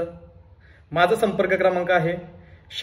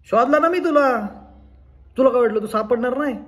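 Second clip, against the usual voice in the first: creditor corners his hiding friend, raised high-pitched challenge; loud, high taunt at a caught debtor; confrontational; 8 semitones higher, 6 dB louder than usual. Acted. Man tells the friend he tracked down, loud confrontation.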